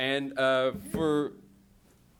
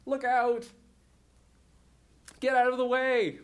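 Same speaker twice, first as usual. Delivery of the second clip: higher, louder shout to clear the road; warning shout; 8 semitones higher, 4 dB louder than usual. Acted. Driver shouting at pedestrians ahead, loud. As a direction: yell at someone in the way.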